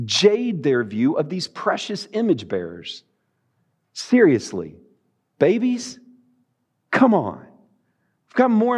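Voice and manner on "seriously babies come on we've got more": mock scornful disbelief. Mock scorn of disciples' view.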